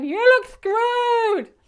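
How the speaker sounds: high, loud, drawn-out shout; shouting over wind. Retold or imitated. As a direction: yell each word slowly over the gale. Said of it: "I'm going".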